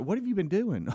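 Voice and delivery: mock exasperated wife; voicing wife. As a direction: demand an answer like a fed-up wife. Wife scolding late husband.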